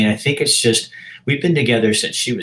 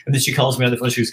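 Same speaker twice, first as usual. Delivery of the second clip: normal talking voice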